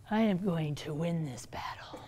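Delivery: low sinister whisper, villain vowing victory; menacing whisper. Pretend. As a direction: hiss out a villain's vow. Voicing the unleashed demon.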